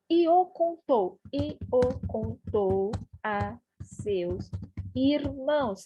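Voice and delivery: "e o contou a seus irmãos" slowly, word by word; deliberate translation. Shown as a demonstration. Teacher translating literally, "e o contou" slow and deliberate.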